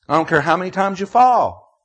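forceful fatherly insistence, preached; emphatic charge. Retold or imitated. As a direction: press it home loudly to a son.

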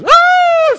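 put-on high, loud sudden cry of a child; child's yelp. Preacher imitating the child crying out after the smack, loud yelp. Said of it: "The child goes".

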